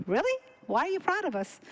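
high, questioning retold reaction; acted surprise. Feigns surprise at doctor's praise.

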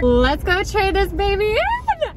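high, loud and slow cheer; excited shout. Real, not acted. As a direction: belt it out with joy. Thrilled, heading to trade car.

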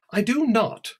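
high, loud offended protest; indignant denial. Acted. Alice protests Celia's rude remark, indignant. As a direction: shoot back, stung.

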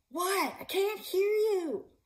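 high, loud, slow shout from far off; yelling back. Acted. Girl shouting back to mom from a distance, loud. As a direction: call out as if she can't hear.

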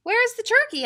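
high, loud question; farmer's wife calling. A narrator's reading. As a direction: ask loudly in a high voice. Farmer's wife demands the missing turkey.

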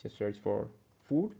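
ordinary talk

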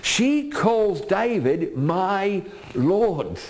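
high, slow, drawn-out emphasis; fervent preaching. Acted. Preacher to congregation, slow emphatic preaching. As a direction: stretch it out for the flock. Preacher stressing her words.

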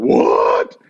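a floored gasp of disbelief on hearing who's calling; stunned what. Retold or imitated. Stunned by celebrity phone call.

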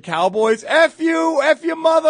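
high, loud taunting shout; imitated heckler yell. Fans taunting the visitors in the parking lot, loud mocking yell. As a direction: spit it out like a rowdy fan. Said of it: "we're just like".

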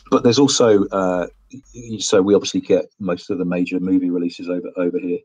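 normal talking voice

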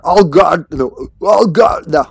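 loud, high panic; panicked shout. Real, not acted. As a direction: yell it as things go wrong. Panicking in the fight.

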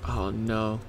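ordinary talk, nothing marked

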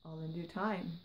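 purring. A narrator's reading. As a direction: let it purr out, half a secret. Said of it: "purred the hooded figure".

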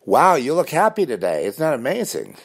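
high-pitched gushing imitation; put-on wonder; mock amazement. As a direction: play a stranger marvelling, pitched well up. Voicing an amazed stranger.

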